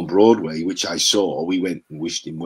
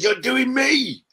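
mock Ray on the phone; 4 dB louder than usual. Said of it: "He said".

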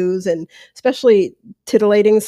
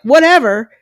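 high, louder brush-off; mock dismissive; 5 semitones higher, 6 dB louder than usual. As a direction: toss it away carelessly. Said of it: "laugh it off and be like".

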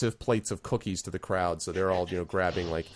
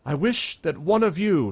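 town leader voice; 8 semitones higher, 4 dB louder than usual. Acted.

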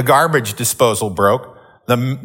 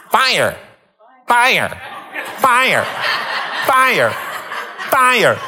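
mock alarm voice; 8 semitones higher, much slower than usual. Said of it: "this voice comes from the house".

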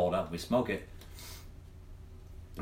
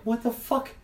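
baffled; 13 semitones higher, slower than usual. Retold or imitated. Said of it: "I'm like".

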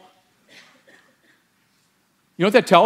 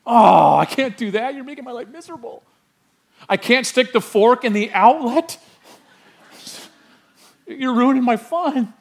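put-on high, complaining child voice, much higher; whiny kid; 7 semitones higher than usual. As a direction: whine like a kid denied fun. Pastor mimics whining children.

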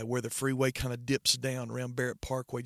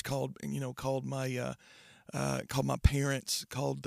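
ordinary talk, nothing marked

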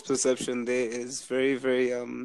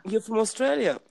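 put-on quoting the girl; 10 semitones higher than usual.

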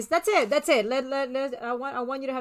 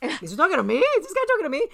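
higher, incredulous aside to a friend; mock incredulous; 5 semitones higher than usual. Telling a friend about a guy approaching her, mock incredulous. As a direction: ask it in put-on disbelief. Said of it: "I was with my friend Marilyn going".

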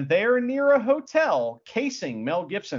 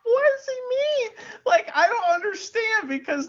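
high-pitched incredulous near-wail; baffled, laughing. Real, not acted. Host baffled by a movie line, laughing incredulously. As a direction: squeal it through helpless laughter.

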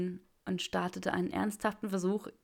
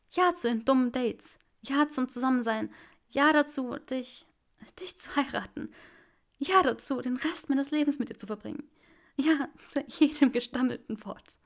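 higher, louder, tearful joy; Granger's joyful yes; 6 semitones higher, 4 dB louder than usual. Acted. Granger answers Draco's proposal, higher and overjoyed. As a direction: pour out yes after yes, higher.

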